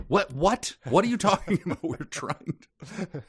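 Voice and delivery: mock bewildered alien; frantic confused alien. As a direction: play a panicked little creature who can't follow.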